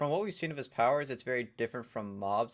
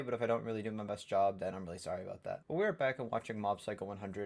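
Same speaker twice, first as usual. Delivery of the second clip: ordinary delivery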